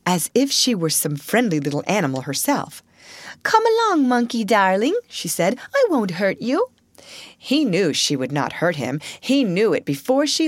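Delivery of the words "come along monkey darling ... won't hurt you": high, gentle coaxing to a pet; coaxing a shivering monkey inside. A narrator's reading. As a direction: lure him in kindly. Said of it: "speaking in a coaxing voice".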